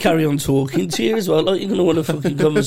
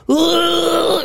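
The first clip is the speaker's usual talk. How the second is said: mock retching; 11 semitones higher than usual. To mates, retelling being sick, mock retch. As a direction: mimic throwing up.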